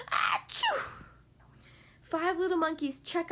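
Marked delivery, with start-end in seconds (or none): 0.1-0.9 fake sneeze. Monkey sneezes.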